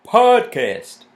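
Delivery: "Podcast." sorti très haut et très fort, avec un p soufflé; "Podcast." lancé tout en haut, fort, p bien aspiré; trying the word out. Shown as a demonstration. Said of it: "Is that how you say it?".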